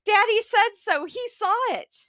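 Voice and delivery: high-pitched frantic yell. A narrator's reading. Mother insists there is a spider, yelling. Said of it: "pounding on the bed and yelling".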